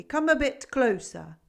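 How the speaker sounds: put-on old goat beckoning; gruff goat calling. A narrator's reading. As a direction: call the stranger closer, gruffly. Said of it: "A voice gruff and cobwebby".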